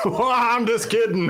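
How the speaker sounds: spring the joke playfully; teasing. Retold or imitated. Retold punchline.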